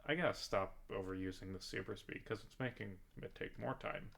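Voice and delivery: quiet distracted note to self; muttering aside. Real, not acted. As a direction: mumble it to yourself mid-game. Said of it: "aside while playing".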